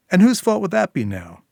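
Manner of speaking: wife turning on her partner, accusing; angry retort. Acted. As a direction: blame him, sharp and loud. Sil snaps back at Declan.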